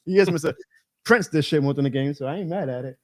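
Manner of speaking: ordinary talk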